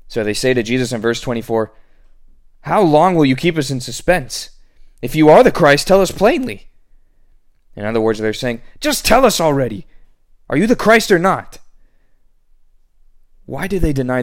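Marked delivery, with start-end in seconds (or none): 2.6-6.6 higher, louder impatient challenge; raised impatient demand; demanding leaders. Acted. Leaders demanding answer from Jesus. 8.8-11.6 higher, louder exasperated demand; mock exasperated paraphrase; impatient leaders.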